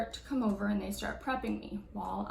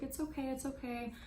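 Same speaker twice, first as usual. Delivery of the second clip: soothing nurse; 4 semitones higher, 7 dB quieter than usual. Retold or imitated. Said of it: "she's like".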